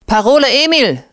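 high, jaunty call. A narrator's reading. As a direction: shout it as a parting watchword. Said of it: "rief er".

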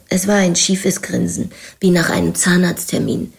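ordinary delivery

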